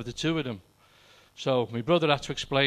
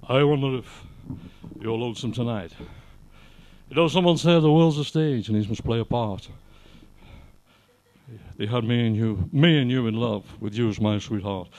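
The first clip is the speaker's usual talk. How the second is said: lightly voiced [name] spoken monologue; [name] talking part; 5 semitones lower than usual. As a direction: murmur it like [name]'s spoken verse. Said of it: "Now that's [name] talk".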